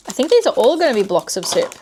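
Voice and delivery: ordinary talk, nothing marked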